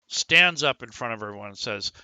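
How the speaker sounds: ordinary talk, nothing marked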